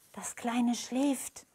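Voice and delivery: put-on slow, soft old woman's hush; whispered. A narrator's reading. Old woman to the nurse about the sleeping baby, hushed. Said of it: "flüsterte sie".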